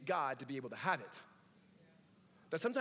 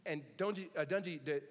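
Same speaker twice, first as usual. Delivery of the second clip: normal talking voice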